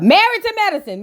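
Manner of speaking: triumphant title callout; emphatic correction. Real, not acted. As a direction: announce the right show name with emphasis. Correcting the show's name.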